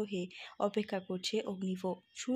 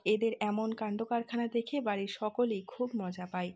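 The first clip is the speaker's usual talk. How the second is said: plain reading voice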